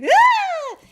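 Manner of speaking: high shrieking yelp; startled scream. Acted. Skater boy screams rolling past the group. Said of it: "and he's like".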